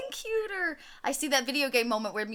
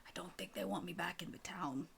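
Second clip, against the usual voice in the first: near-whispered, much softer; hushed, lower confession; sheepish admission; 5 semitones lower, 16 dB quieter than usual. Acted. Murdy admits she is unwelcome.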